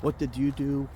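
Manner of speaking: normal talking voice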